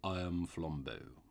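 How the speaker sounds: low, slow admission. A narrator's reading. Robber reveals his name to the priest, low voice. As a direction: drop low and take it slowly. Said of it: "in the same low voice".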